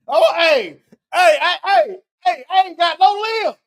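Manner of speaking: mocking call-out. Commentator playing a limbless volunteer hailing people, mocking. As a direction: call out as the hammed-up helpless volunteer. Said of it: "that's why I'm mocking this".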